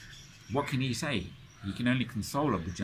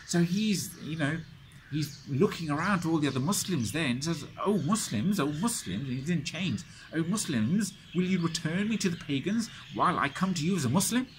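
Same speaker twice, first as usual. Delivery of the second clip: 1 pleading cry; 7 semitones higher than usual. Retold or imitated. Abu Jandal crying out to Muslims.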